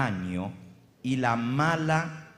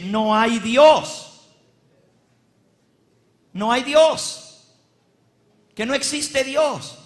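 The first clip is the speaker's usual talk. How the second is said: proclaimed verse; 9 semitones higher, 10 dB louder, slower than usual. Acted. Proclaiming scripture aloud.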